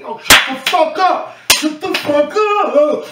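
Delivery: high, loud imitated shout, the second one slowed; retold angry yell. Lace yelling at his screaming friend after a shock, loud and angry. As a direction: bark it like a friend snapping you out of panic. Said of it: "Lace was slapping the shit out of me".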